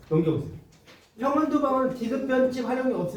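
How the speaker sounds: ordinary talk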